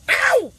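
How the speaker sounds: sharp high loud yelp; real startled ow; sudden yelp of pain. Real, not acted. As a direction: cry out as the spoon hits your mouth. Spoon hits his mouth.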